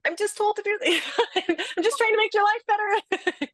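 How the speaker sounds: high, laughing mock plea; defensive pleading. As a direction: squeak it out as an anxious salesperson.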